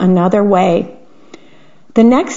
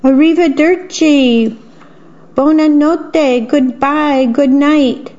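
higher, slow farewells called across the yard; drawn-out cheerful goodbyes; calling out goodbyes; 5 semitones higher, slower than usual. A narrator's reading. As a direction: sing out each goodbye to departing guests. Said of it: "called out".

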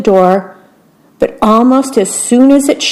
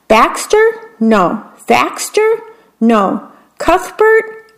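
high guessing queen; 8 semitones higher, slower than usual. A narrator's reading.

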